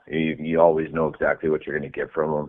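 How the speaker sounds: ordinary talk, nothing marked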